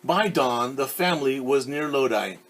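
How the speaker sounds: clear, lively read-aloud voice; storyteller reading a picture book; story narration. A narrator's reading. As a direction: read it to a class of kids.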